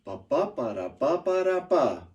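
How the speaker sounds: sing-song "ba ba ba da ba ba da ba" with strong beats; bouncy rhythmic nonsense syllables; limerick beat. Shown as a demonstration. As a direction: chant the beat, punching every third syllable. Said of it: "it goes with a certain beat".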